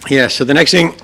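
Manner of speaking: losing voice, a bit hoarse. Real, not acted. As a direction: talk through a hoarse throat. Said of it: "still losing my voice".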